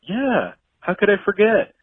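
high, breezy mock sincerity; light flattering reply; smooth charmer. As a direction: play it cool and charming. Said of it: "And I go".

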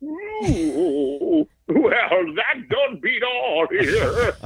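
put-on high, slow, prissy old-timey voice; Victorian gentleman. Mocking a host as a scandalized Victorian gentleman. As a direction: drag it out like a shocked Victorian. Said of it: "like, Victorian era".

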